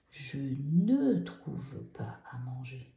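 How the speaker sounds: slow, careful "Je ne trouve pas à manger"; slow model negation. Shown as a demonstration. Teacher modelling the negative form slowly. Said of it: "on met le N apostrophe".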